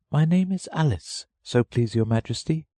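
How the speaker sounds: put-on high, polite girl's voice. A narrator's reading. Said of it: "very politely".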